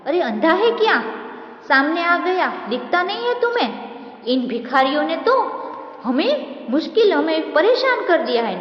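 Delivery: high, sharp, rude rebuke; schoolboy cyclist scolding the beggar. Acted. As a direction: snap at the blind man for being in the way.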